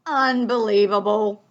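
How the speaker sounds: loud, exasperated bear; rumbling bear. Acted. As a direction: rumble your disbelief. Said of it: "rumbled the bear".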